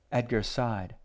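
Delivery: plain reading voice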